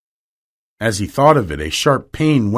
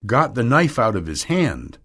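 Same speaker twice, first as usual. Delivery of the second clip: ordinary delivery, nothing marked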